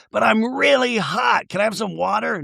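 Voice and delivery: high, lightly mocked plea; [name]. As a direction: imitate someone overheated and begging.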